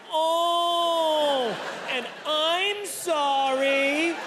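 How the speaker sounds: very high, very slow oh and apology; high embarrassed apology. Retold or imitated. What the doctor had to deal with, high drawn-out apology. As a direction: replay the squeak and drawn-out sorry. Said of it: "he's had to deal with".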